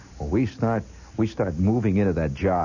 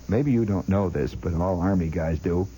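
ordinary talk

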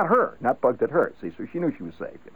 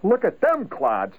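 slow, higher jeer at the people nearby; bugged holler; 9 semitones higher, slower than usual. Shown as a demonstration. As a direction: sneer at those around you. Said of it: "if somebody hollers".

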